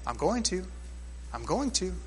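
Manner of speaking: a gentle, near-hushed "I'm going to"; soft reply. Acted. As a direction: say it softly, like a patient promise. God answering softly.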